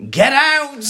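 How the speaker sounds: high, loud retold order to leave; mock shout. Receptionist throwing out a covid patient, high mock shout. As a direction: imitate a horrified receptionist yelling.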